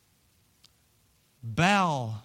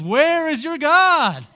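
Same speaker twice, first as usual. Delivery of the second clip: loud, slow, high mocking call; mocking taunt; 14 semitones higher, 10 dB louder, slower than usual. Elijah jeering at the false prophets, loud and slow. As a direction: taunt them with a raised, dragged-out voice. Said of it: "Elijah starts mocking them".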